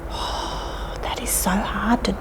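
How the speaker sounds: soft unvoiced whisper for a quiet clap; a playful whisper to the toddlers. Shown as a demonstration. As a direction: hush it right down. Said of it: "we'll do a quiet clap".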